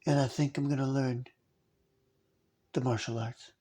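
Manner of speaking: soft, low voice of an awkward sixth-grader; halting hushed delivery with long pauses, like a lonely kid; Steven Seagal as a child. Acted. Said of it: "who talked like this".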